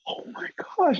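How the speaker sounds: whispered, high-pitched awe; starstruck gasp. Retold or imitated. Warm-up comic sees Dick Clark, starstruck whisper. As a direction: gasp it out under your breath, overwhelmed.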